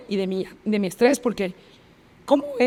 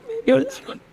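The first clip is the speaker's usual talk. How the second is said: higher-pitched, imitated crying confession; tearful; 4 semitones higher than usual. Crying girl repeating it to her friend, tearfully. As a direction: let the retold words break into weeping.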